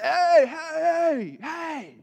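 high, loud calling out, easing off; dad voice. Retold or imitated. Dad breaking up his daughters' fight, calling out. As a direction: call the squabbling kids to order. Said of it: "I gotta do the dad voice".

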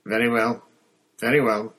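loud, irritated teacher giving in; snapped. A narrator's reading. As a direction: give in with sharp impatience. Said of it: "Snape snapped".

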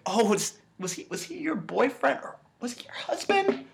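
high, soft mock-concerned villain voice; high, half-whispered, maniacal questioning. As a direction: imitate a maniac taunting with fake concern. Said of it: "Like, maniacal shit".

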